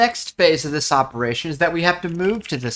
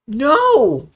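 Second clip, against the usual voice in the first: higher, drawn-out wail of no; dramatic cry of no; 7 semitones higher, 4 dB louder than usual. Real, not acted. Streamer reacting to a missed punch, crying out. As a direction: cry out in protest, pitch leaping up. Mock alarm at game events.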